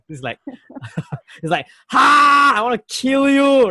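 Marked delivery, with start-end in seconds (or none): 1.9-3.7 high, loud mock rage; mock murderous shout. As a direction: shout it like you want to kill them. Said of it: "kind of feeling".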